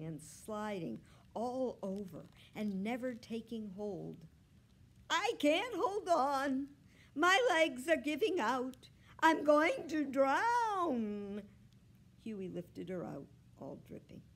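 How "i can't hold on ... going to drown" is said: loud, high, slow wail; desperate drawn-out yowl; panicked cry for help. A narrator's reading. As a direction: wail as if about to drown. Cat drowning cries for help.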